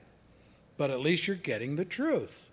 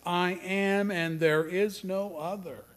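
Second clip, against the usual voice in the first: "I am" raised and drawn out slowly; emphatic declaration; 6 semitones higher, 3 dB louder, slower than usual. Shown as a demonstration. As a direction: lean slowly on "I am" as an absolute claim. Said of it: "it's emphasis, emphasis, emphasis".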